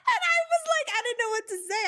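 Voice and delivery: high, loud laughter spilling into words; laughing hard. Real, not acted. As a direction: laugh through the memory. Laughing at the memory.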